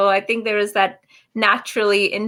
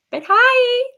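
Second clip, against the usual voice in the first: high cheery greeting; 13 semitones higher, 6 dB louder than usual. Real, not acted. Greeting the kids watching.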